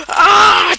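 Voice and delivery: loud high acted scream. Kelsey screams inside the snapping suit, loud high scream. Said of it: "Kelsey started screaming".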